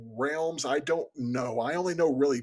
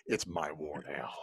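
deep, low, soft tough-guy growl; action movie hero line; 10 semitones lower, 9 dB quieter than usual. Retold or imitated. Describing the film moment to his co-host, deep and grim. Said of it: "that moment in the film where it's like".

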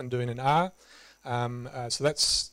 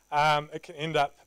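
ordinary talk, nothing marked

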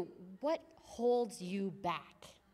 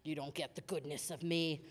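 low shutting down; 5 semitones lower, 6 dB quieter than usual. Retold or imitated. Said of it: "I'm like".

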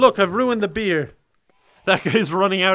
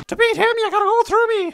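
much higher silly voice for the little guy; high cartoon sidekick voice; squeaky character voice. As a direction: voice him as a tiny hero. Voicing game character.

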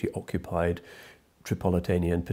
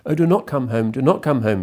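raised, louder cry of do not come home; wailing plea; 6 semitones higher, 8 dB louder than usual. Acted. Wife begging her husband not to come home, wailing. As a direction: beg him through tears, high and loud. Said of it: "she wailed".

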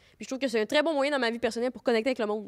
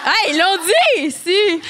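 excited laughing shout; 6 semitones higher, 12 dB louder, much slower than usual. Real, not acted. Excited reaction to onstage shout.

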